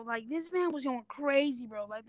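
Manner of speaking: ordinary delivery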